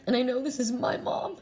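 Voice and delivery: high, tearful voice, choking up; crying. Real, not acted. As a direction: speak through real tears. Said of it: "Sorry, crying again".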